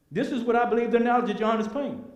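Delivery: normal talking voice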